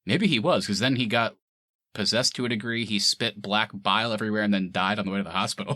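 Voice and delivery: normal talking voice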